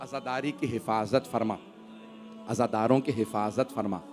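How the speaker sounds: soft, low, devout supplication; hushed prayer. Acted. Preacher closing the majlis with prayer for mourners, hushed supplication. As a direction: pray quietly, close to the mourners.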